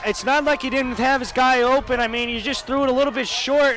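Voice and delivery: insistent color commentary; fired-up analysis. Real, not acted. Analyst fired up after pick.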